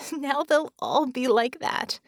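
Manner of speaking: a tired, weak laugh while speaking. A narrator's reading. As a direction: laugh weakly through the words. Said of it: "She laughed weakly".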